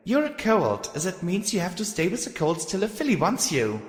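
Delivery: high, bossy filly guard voice; bossy young filly. Acted. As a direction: order him back, high and bossy. Filly character voice.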